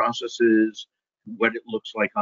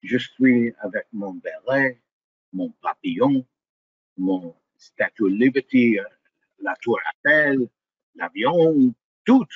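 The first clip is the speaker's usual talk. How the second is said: French chef. Pretend.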